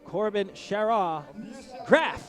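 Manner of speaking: loud, drawn-out race-announcer roll call; announcer lineup. Acted. Commentator announcing the heat one riders to the crowd, in a loud drawn-out call.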